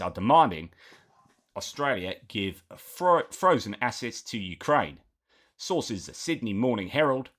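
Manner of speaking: a mock Aussie bloke reading the news badly on purpose; bad Australian accent. Commentator jokingly reads Australian news in a bad Australian accent. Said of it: "incredibly bad, uh, Australian accent".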